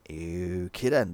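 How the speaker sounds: low, drawn-out dismissal; unimpressed. Real, not acted. Reviewer reacting to a silly film argument, unimpressed.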